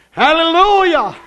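high ringing praise shout; preacher's exultant cry; shouted hallelujah. Real, not acted. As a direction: lift it up high and loud. Preacher exclaims.

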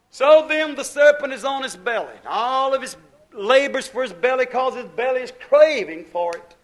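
fervent preaching. Real, not acted. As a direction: proclaim it forcefully from the pulpit.

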